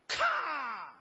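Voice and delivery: loud, high single crack "咔"; Mandarin crash noise. Shown as a demonstration. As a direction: imitate a ship snapping with one loud 咔. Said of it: "普通话是这样的". (普通话版大声“咔”)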